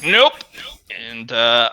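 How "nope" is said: high, loud snappy no; quick refusal. Retold or imitated. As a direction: blurt out a quick nope. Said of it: "you're just like".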